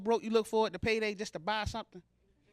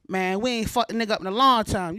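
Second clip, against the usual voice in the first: louder tough guy threat, lightly voiced; mock thug taunt, a bit louder; tough street guy; 6 dB louder than usual. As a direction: play the corner guy itching for a fight. Street guys voiced in joke.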